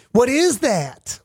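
high, drawn-out mock wonder; exaggerated coy coworker imitation; feigned curiosity. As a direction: stretch it slowly, playing innocent. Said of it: "hidden agenda and you say".

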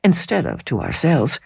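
plain reading voice

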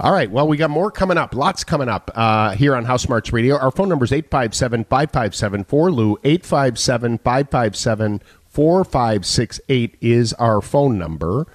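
upbeat radio host voice. Acted. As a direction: announce the station and number. Radio host announcer read.

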